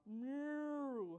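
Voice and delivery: long, swelling cow moo. Acted. Cow pulled out of tummy.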